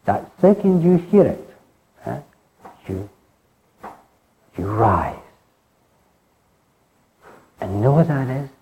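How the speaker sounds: usual speaking voice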